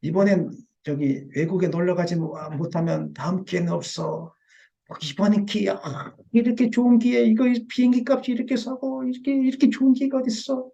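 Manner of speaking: a hammed-up tempter selling a bargain flight; Satan tempting. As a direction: dangle the cheap trip, voice climbing. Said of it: "사단이 주는 것은".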